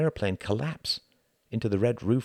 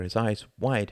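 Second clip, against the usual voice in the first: ordinary delivery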